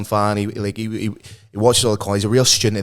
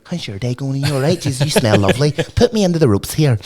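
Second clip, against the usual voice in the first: wrestler voice; 3 semitones higher than usual. Retold or imitated. Wrestler in a lockup, sweetly chatting to opponent. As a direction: sweet-talk your opponent mid-hold.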